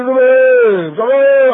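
loud, high, whiny retort; teen shouting at his mother, high and loud; bratty yell at mother. Retold or imitated. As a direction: snap at mom like a spoiled teen. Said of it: "قول لي".

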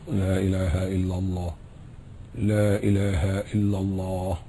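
quiet inner shahada, lips still. Shown as a demonstration. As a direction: say it almost silently under the breath. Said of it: "شفايفك ثابتة أهي".

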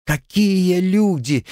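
high, slow mocking echo; mocking mimicry. A narrator's reading. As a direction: mimic him scornfully. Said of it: "передразнила его девушка".

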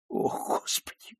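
whispered breathless prayer; dying gasp. Acted. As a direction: gasp it, near death. Dying man sighs before shot.

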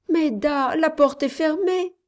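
put-on high-pitched little girl's voice; child's alarmed protest. A narrator's reading. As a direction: pipe up like a worried child. Frightened child answers nurse. (voix aiguë d'enfant inquiet)

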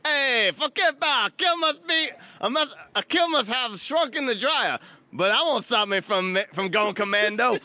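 put-on New York accent. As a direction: ham up a New York tough guy. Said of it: "I can't do New Yorker".

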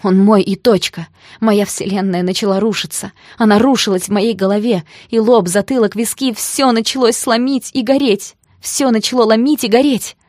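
loud, rising despair; anguished outburst. A narrator's reading. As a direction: build to a desperate cry. Heroine's world collapsing, frantic.

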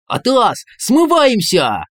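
high, loud panicked yell, dragged out slowly. Acted. Inner voice yells to flee the police siren, panicky. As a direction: shout the alarm to run. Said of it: "заорал голос".

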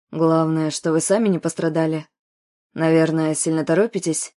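low, pleasant man's voice; velvety male voice. A narrator's reading. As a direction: soothe her with a deep, velvety tone. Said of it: "приятным бархатистым голосом". (бархатистый мужской голос)

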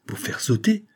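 high-pitched, startled question; shocked exclamation. A narrator's reading. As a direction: exclaim it in disbelief, voice rising high. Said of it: "s'écria Grain de sel". (s'écrier, stupéfait)

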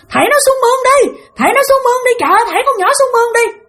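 very high, urgent imitated yell; mock shouting of panicked instructions; hollering. Bystander urging others to throw child in ditch, hollering. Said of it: "có một người la làng lên".

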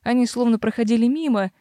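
ordinary delivery, nothing marked

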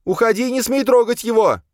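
high, loud frantic warning; furious high-pitched shout; yelling. A narrator's reading. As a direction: scream it to chase him away. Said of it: "заорала Долорес".

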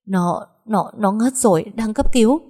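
stammering, rising to a loud cry; panicked stammer. Acted. Hoa telling her sister on the phone her brother collapsed, stammering and loud.

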